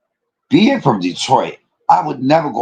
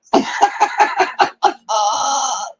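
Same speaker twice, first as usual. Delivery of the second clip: high laugh; 13 semitones higher than usual. Real, not acted. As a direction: burst into a shrieking laugh. Laughing loudly.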